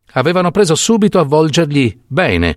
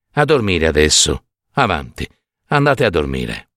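gentle coaxing; 8 semitones lower than usual. A narrator's reading. Doctor soothing the old woman.

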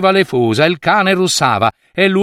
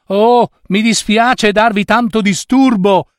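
higher, raised voice over the screaming; loud voice; 7 semitones higher than usual. A narrator's reading. Baker apologising over the screaming baby, loud. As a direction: call out above a crying baby. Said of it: "disse a gran voce la donna".

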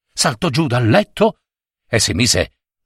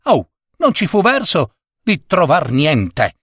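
ordinary delivery, nothing marked

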